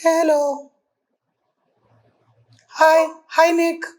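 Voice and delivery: very high-pitched prank-caller voice; disguised voice. Pretend. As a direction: put on a high fake voice to fool a friend. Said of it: "આવાજ change કરો". (બદલેલો, ખૂબ ઊંચો અવાજ)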